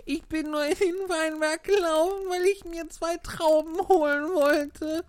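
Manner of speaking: very high tearful excuse; sobbing Pinocchio. Acted. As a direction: plead high with a touch of sobbing. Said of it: "rief Pinocchio schluchzend".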